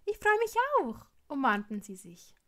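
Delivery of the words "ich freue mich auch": high, loud burst of gladness; thrilled, pitched right up; delighted reply. A narrator's reading. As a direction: gush it happily into a hug. Glad greeting as friends embrace.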